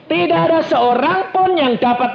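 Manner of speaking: high, ringing proclamation of a verse; forceful scripture reading. Real, not acted. Preacher reading John's words to the congregation, ringing scripture reading. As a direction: proclaim the verse to the whole hall.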